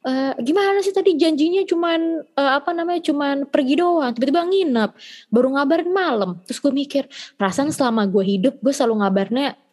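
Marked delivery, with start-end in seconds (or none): 0.1-6.3 higher mock mom complaining; imitating nagging mom. Mom scolding daughter for late notice, higher mock nag. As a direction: play mom scolding, lightly put on. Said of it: "nyokap gue bilang kayak".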